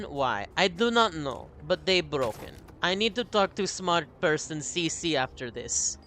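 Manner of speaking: put-on a light playful Russian accent with dropped articles; a hint of a Russian accent, half-joking. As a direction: speak broken English like a hammed-up Russian. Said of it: "Why am I doing Russian voice?".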